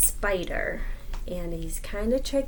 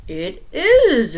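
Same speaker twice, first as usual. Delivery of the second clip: loud elephant reply; a big booming yes; 4 semitones higher, 9 dB louder than usual. Acted. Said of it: "agreed Elephant".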